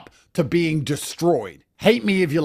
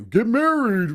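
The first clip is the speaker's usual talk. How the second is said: mocking conservative advice; 5 semitones higher, slower than usual. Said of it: "these conservative losers tell you".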